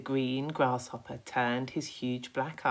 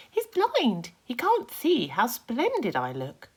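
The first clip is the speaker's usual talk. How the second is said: much higher, louder cocky insect voice; a boastful little bug, pitched way up; Centipede voice; 11 semitones higher, 6 dB louder than usual. Acted. Centipede character voice.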